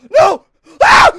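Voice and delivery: loud high scream; shrieking in terror; scream of fright. Real, not acted. Monster jumpscare.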